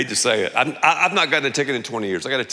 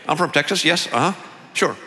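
closed-mouth Texas accent. Shown as a demonstration. Pastor mimicking guys from Texas in their forties, closed-mouth Texas accent. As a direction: mumble Texan with the jaw nearly shut. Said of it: "they don't open their mouths very much".

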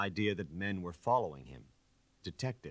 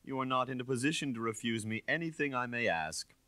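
condescending; 6 semitones higher than usual. Acted. Inspector condescending threat.

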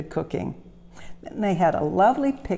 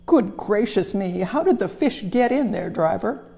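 astonished; 4 semitones higher, 3 dB louder than usual. Acted. Astonished controller.

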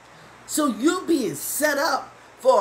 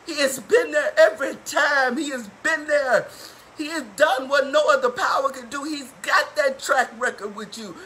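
raised, higher-pitched, impassioned testimony; fervent preaching; 4 semitones higher, 5 dB louder than usual. Real, not acted. Preacher testifying that God has been there, fervent and loud. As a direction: proclaim it loudly with rising fervor.

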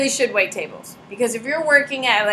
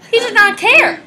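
much higher, louder incredulous burst; exasperated outburst; 11 semitones higher, 8 dB louder than usual. Real, not acted. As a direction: burst out in disbelief.

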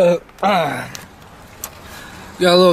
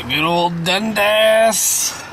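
slow drawn out; much slower than usual. Shown as a demonstration. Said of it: "repeated phrase".

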